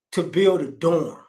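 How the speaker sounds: ordinary delivery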